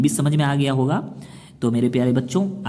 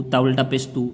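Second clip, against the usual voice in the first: quick, with a short "तू"; unstretched reading. Shown as a demonstration. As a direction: say "तू" briefly, without holding it. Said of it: "तो ये गलत हो जाएगा". ("तू" बिना खींचे, जल्दी में)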